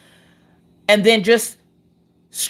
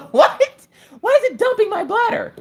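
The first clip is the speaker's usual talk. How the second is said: laughing. Real, not acted. Laughs at bladder joke.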